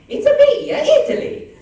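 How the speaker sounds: high, loud, giggling Italian voice; Italy character voice. Acted. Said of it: "do your favorite Italy quote".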